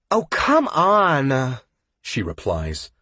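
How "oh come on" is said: high, slow, slurred coaxing; drunk whining. A narrator's reading. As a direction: whine drunkenly, oblivious. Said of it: "undeterred and oblivious".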